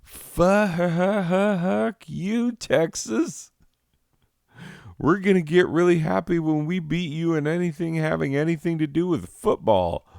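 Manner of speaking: high, loud, fast defiant squeal; Oklahoma talking back. Retold or imitated. Oklahoma yelling back at Texas, high loud squeal. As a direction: shriek back at Texas like a jilted rival. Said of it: "and Oklahoma is like".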